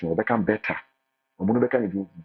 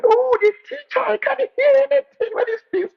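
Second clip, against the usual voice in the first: mock student complaint; 8 dB louder than usual. Students mocked complaining about teacher.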